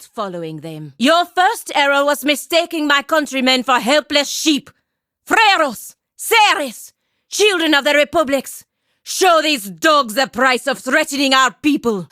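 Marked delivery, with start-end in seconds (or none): 1.0-4.7 shouted taunt. Acted. Battle taunt, shouted take. 5.3-8.7 battle cry. Acted. Rallying call, shouted take. 9.0-12.1 shouted command. Acted. Battle order, shouted take.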